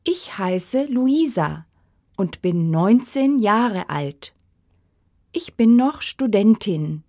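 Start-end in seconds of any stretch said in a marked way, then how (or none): none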